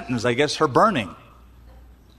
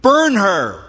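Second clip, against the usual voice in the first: mock verdict; 5 semitones higher, 5 dB louder than usual.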